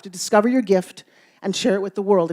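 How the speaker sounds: ordinary talk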